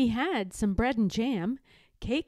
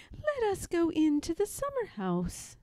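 higher, lightly voiced grandmother; gentle grandmother; 9 semitones higher than usual. A narrator's reading. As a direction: invite him along sweetly. Grandmother inviting cub.

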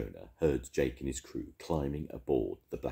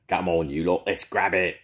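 shouted; 5 semitones higher, 6 dB louder than usual. A narrator's reading. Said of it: "shouted Jake".

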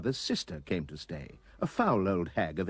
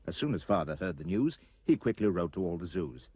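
plain reading voice

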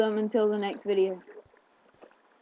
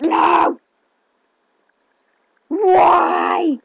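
crying shout, pitch up high; despairing wail of no; anguished yell; 8 semitones higher, 12 dB louder than usual. Real, not acted. Anguished outburst at game mishap.

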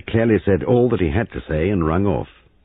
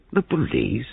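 incredulous; 8 semitones higher than usual. Acted. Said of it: "Giles sounded incredulous".

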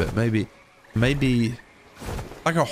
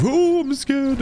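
high, mock-frightened squeak; jokey fake fear going into the cave; mock scared; 13 semitones higher, 3 dB louder, slower than usual. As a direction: pretend to be scared, hammed up. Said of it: "rather than me right now going into the cave".

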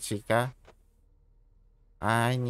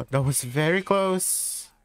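startled gasp; 9 semitones higher than usual. Real, not acted. Narrow escape in horror game.

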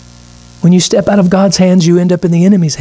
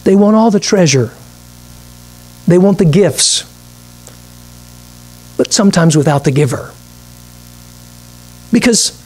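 ordinary talk, nothing marked

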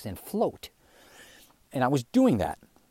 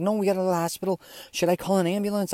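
high-pitched imitated urging; retold urgency in a raised pitch; urgent worried cousin; 8 semitones higher, faster than usual. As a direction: plead like an alarmed friend.

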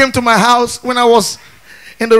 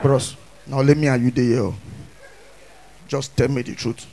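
much lower, softer, quiet threat; low menacing thug; 12 semitones lower, 11 dB quieter than usual. Retold or imitated. A thug quietly pressing the MC about the act.

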